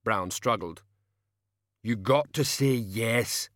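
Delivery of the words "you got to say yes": slow, odd hulking voice; rumbling brute. Acted. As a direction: menace like a slow giant. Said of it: "Banjo said".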